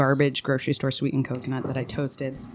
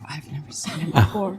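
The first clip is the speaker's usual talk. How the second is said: whisper. Retold or imitated. Denying knowing the kid, hushed.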